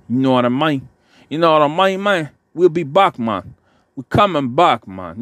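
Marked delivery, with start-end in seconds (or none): 1.3-5.2 hammed-up Jamaican accent, pitched higher; a rough, jokey go at a Jamaican accent. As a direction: throw on a playful Jamaican accent. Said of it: "that Jamaican and Scarface".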